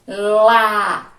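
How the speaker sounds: "Lá" very loud and held long; loud, drawn-out "Lá" for counting syllables; isolated syllable demo. Shown as a demonstration. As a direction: belt out "Lá" as one stretched syllable. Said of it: "Quantas vezes você abre a boca?".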